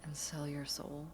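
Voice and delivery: hushed, pointed whisper. Acted. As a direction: breathe the question unvoiced.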